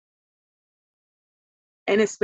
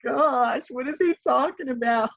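retold surprise; 6 semitones higher than usual. Mother retells to her daughter how they reacted to the news, in shocked disbelief. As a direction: act out the parents' stunned reaction. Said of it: "And we was like".

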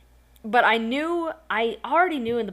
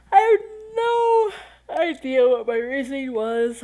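words stretched higher and louder by a real yawn; 6 semitones higher, 6 dB louder than usual. Real, not acted. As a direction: talk through a big yawn. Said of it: "I'm sorry I'm yawning".

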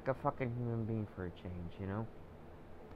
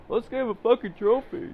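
high, loud sarcastic voice; mock the give-everyone-a-trophy crowd; mocking sing-song; 12 semitones higher, 8 dB louder than usual. Vlogger mocking trophy culture, high loud sarcasm.

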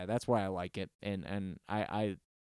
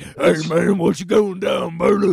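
loud mock metal-singer voice; metal singer offstage; 5 semitones higher, 10 dB louder than usual. Host joking metal singers talk so offstage, loud put-on voice. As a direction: keep the stage voice on in casual chat, loud. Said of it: "don't get off stage and like".

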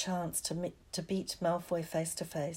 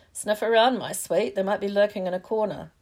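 loud, sinister caretaker voice; prowling Filch; 2 semitones higher, 9 dB louder than usual. A narrator's reading. Filch speaking to Missus Norris while hunting students, menacing. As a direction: urge the cat on to hunt.

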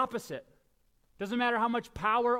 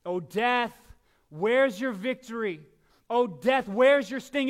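triumphant taunt; 4 semitones higher, 9 dB louder than usual. Acted. Preaching climax taunting death.

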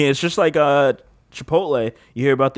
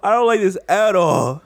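laughing; 5 semitones higher than usual. Real, not acted. Laughing after gross joke.

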